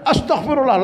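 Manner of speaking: high-pitched imitation of a startled cry; mock shocked cry. Elder sheikh spots the unshaved armpit, mild mock yell. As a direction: exclaim it high, as if scandalised. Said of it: "Dia langsung teriak".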